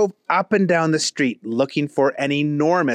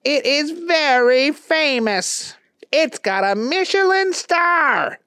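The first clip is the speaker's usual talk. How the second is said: high, odd put-on voice; squeaky character voice; Keith voice. A narrator's reading. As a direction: talk it up like a know-it-all pal. Keith gives directions.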